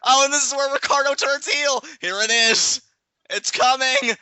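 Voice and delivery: high, loud excited commentary; hammed-up anticipation. Teasing co-host about the predicted heel turn, hammed-up excitement. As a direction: build up the big moment in a high voice.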